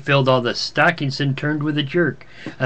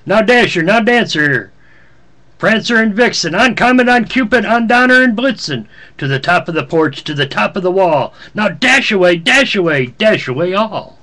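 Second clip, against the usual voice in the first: loud, high rallying calls to the team; shouting to reindeer; 5 semitones higher, 5 dB louder than usual. Acted. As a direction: call each reindeer out like a driver urging them on. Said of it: "He whistled and shouted and called them by name".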